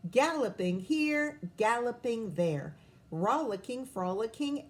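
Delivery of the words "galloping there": plain reading voice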